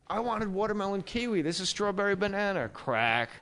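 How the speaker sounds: high-pitched, hammed-up petty rage; mock outburst. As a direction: act out snapping over a tiny letdown. Acted snap over wrong gum flavour.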